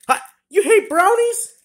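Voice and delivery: high, loud incredulous question; shocked disbelief. Acted. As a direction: shoot up in pitch, appalled. Shocked that friend hates brownies.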